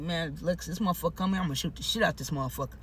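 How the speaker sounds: low, soft, grim warning; low threat. Retold or imitated. Resident with a gun warning Lex, low quiet threat. Armed, warns Lex about the intruder.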